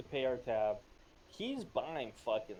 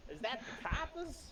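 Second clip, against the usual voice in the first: put-on old-time gangster voice; 10 semitones higher than usual. Joins the gangster bit.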